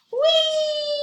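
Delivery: very high squeal of delight; fly and squeal with joy; joyful whee. Acted. Ball flying joyfully.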